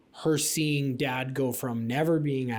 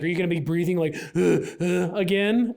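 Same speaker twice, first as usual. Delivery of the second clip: higher, louder imitation of a cheeky kid; mock panting kid question; mimicking daughter; 5 semitones higher, 5 dB louder than usual. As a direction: tease like a nine-year-old asking. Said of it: "she's like".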